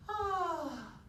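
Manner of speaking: high, loud sighed "ah"; short sigh that stays up high; head-voice sigh. Shown as a demonstration. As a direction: sigh without dropping into chest voice. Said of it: "they'll give you the sigh".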